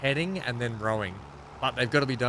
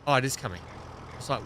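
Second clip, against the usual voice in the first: normal talking voice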